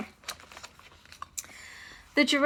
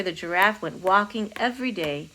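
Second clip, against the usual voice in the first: usual speaking voice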